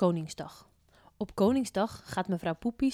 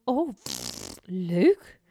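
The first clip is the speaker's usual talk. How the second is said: flustered; 5 dB louder, much slower than usual. A narrator's reading. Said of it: "begint te blozen".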